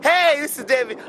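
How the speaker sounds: mock a radio DJ intro; imitating a DJ greeting on air. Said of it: "your normal voice and then".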